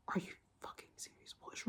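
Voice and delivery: disbelieving whisper; incredulous hushed whisper; whispered aside. Retold or imitated. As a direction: whisper it under your breath in disbelief. Private reaction to the doctor.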